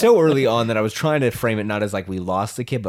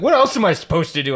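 higher-pitched retold fluster; mild mock panic; 4 semitones higher, 4 dB louder than usual. Friend learns he'll be a dad, mock panic. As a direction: blurt it as a panicking dad-to-be, lightly acted.

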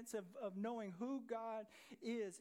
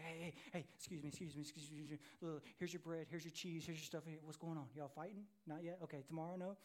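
casual David greeting; 4 semitones lower, 4 dB quieter, faster than usual. Retold or imitated. David casually greeting brothers at battle.

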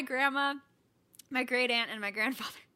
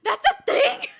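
loud, much higher, slow astonished laugh; amazed laugh; 11 semitones higher, 12 dB louder, much slower than usual. Real, not acted. Friend reacting to Mario's restaurant story, amazed and laughing. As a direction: disbelief spilling into laughter.

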